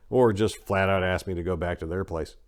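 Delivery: normal talking voice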